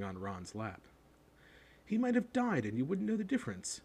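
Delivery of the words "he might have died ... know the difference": high-pitched disgust; exasperated boy's scorn. A narrator's reading. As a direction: complain in disgust about a useless pet. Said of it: "said Ron in disgust".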